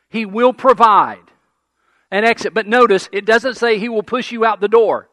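loud, high, driving sermon voice; preaching at full force. Acted. Pastor urging his congregation, preaching loud and high. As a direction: pound it home to the congregation.

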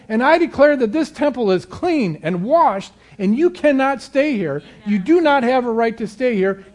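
loud, high, furious command to the intruder; rebuking cancer like a burglar, shouted high and loud; angry rebuke. Pretend. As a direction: drive the burglar out with full force. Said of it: "You say".

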